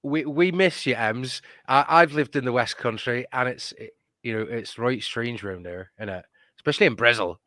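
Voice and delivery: a teasing hint of a West Country voice; low, half-serious West Country twang; put-on West Country accent. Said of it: "I could talk like this for the rest of the stream".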